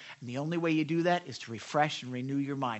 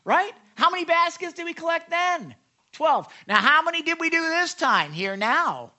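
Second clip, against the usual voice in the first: high, loud, insistent reminder; Jesus prompting disciples; 9 semitones higher, 7 dB louder than usual. Acted. Preacher as Jesus quizzes his disciples, pointedly prompting. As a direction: quiz the group with lively, raised pitch. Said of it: "Jesus is gonna say".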